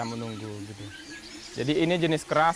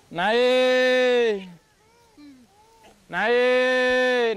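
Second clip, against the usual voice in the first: loud, high call to the apes, stretched out; drawn-out shout to the siamang; 7 semitones higher, 5 dB louder than usual. Real, not acted. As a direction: send them back up the hill with a long call. Keeper calling apes to climb.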